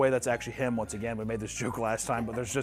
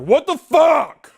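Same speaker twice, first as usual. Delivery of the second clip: loud, high outburst with drawn-out words; imitated freak-out; 13 dB louder, much slower than usual. Co-host mimics an alcoholic rock star freaking out, loud mock outburst. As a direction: explode slowly on every word, mimicking him. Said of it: "He freaks out".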